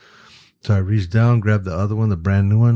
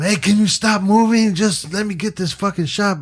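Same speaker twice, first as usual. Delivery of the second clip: much higher, pleading mock yell; exasperated yell; 12 semitones higher than usual. Photographer silently begging moving stars to hold still, exasperated mock yell. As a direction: blurt out what you only dared think. Acts out imagined plea to stars.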